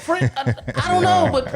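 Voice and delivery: high, breathless starstruck gushing; excited fan. Retold or imitated. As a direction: stammer it out, high and thrilled. Starstruck fan stops them.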